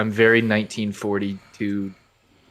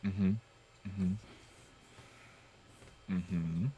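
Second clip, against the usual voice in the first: hiccuping through a mm-hmm; small hiccup catching the sound; 3 semitones lower, 16 dB quieter, much slower than usual. Real, not acted. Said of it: "Got the hiccups".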